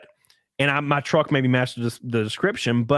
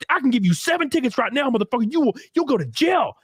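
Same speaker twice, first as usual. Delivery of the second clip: higher, threatening mock yell; imitating cop's yelling; 8 semitones higher than usual. Cop threatening teen driver with tickets, angry mock yell. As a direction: threaten jail like a ranting cop. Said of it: "He's screaming at me".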